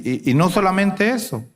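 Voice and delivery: ordinary talk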